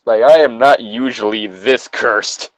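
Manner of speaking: ordinary talk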